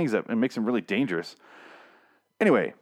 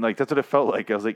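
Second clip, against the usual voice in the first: ordinary talk, nothing marked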